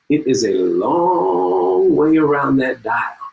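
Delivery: slow, with one long-held vowel on "long"; drawn out. Shown as a demonstration. Speaker shows audience how far the dial turns, drawn out. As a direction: stretch LONG way out slowly.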